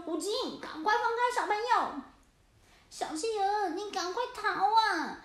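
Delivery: high, urgent call; brave shout. Acted. Cats ordering the orca to release the penguin, urgent shout. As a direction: order the orca away boldly.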